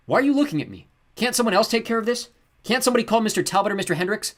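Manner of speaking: high, loud desperate outcry; shouting. A narrator's reading. Luke wanting to shout at the servants. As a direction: cry out to the staring servants. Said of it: "He wanted to shout out".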